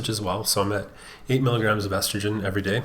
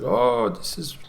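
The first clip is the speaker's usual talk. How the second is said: put-on a light, half-hearted Russian accent on the doctor's words; a hint of a Russian accent for the doctor; 3 semitones higher than usual. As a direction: lightly imitate the doctor in a Russian accent, knowing it's not very good. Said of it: "He's got a Russian accent".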